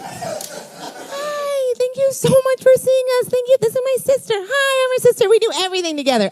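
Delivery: put-on very high, gushing girly voice; hammed-up high-pitched pitch-meeting women; women pitching Miramax. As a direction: squeal thanks like an over-eager sister act. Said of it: "I'll be them".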